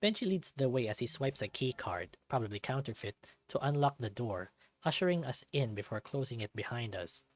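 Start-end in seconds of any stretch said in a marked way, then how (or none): none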